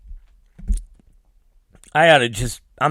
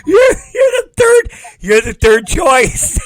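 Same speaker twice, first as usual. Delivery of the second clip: squealing with laughter, much higher; laughing hard; 14 semitones higher, 5 dB louder than usual. Real, not acted. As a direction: laughs through the teasing words. Teasing friend, laughing fit.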